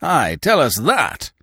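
slow, high, loud roar. A narrator's reading. Binet challenges André before the company, roaring. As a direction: bellow the challenge. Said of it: "roared Monsieur Binet".

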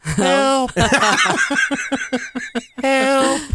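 high, thin mock call for help; faint distant cry. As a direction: call as if muffled behind walls. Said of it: "you might very faintly hear".

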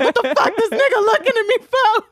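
barely getting the words out for laughing; laughing through the quote. Retold or imitated. The teller voicing the drunk uncle punching at the ice, laughing through it.

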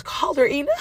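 high mock urgent call for the girl; husband pleading her to call the girl, high-pitched. Husband urging her to call child.

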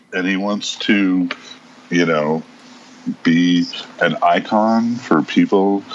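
ordinary talk

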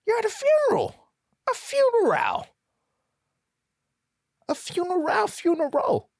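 very high, rising incredulous squeal; quoted meme voice. Retold or imitated. Quoting a meme at Randy, squealing high. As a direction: shriek it like the famous clip. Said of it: "If you know what that's from".

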